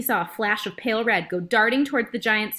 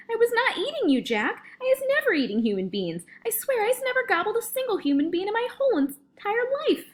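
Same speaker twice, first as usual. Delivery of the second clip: high-pitched desperate denial; panicked screeching; 14 semitones higher than usual. A narrator's reading. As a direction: swear innocence in terror. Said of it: "he screeched".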